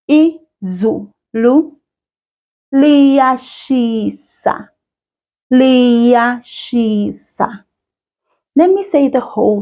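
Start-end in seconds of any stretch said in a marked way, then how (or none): 0.1-1.8 Izulu drawn out slowly with a rising end; patient teacher model of Izulu; slow Zulu demo. Shown as a demonstration. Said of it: "here is how we say it". 2.7-7.6 Liyashisa said very slowly, syllable by syllable; slow, clear model of Liyashisa; slow Zulu demo. Shown as a demonstration. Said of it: "here is how we say it".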